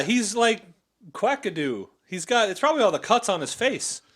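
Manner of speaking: normal talking voice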